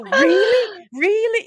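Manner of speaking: high, loud thrilled "Really?"; thrilled disbelief. Retold or imitated. Answering the boss's job offer in thrilled disbelief. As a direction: squeal it out in disbelief.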